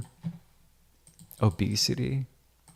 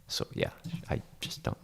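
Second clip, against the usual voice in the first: whisper; 10 dB quieter than usual. Real, not acted. Confiding aside to viewers.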